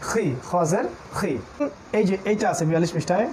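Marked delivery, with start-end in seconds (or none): 0.1-1.3 slow খি with a rasping খ from the upper throat; slow throaty খ demo. Shown as a demonstration. Teacher drilling the খ sound for students, slowly. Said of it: "ওই যে খ জের".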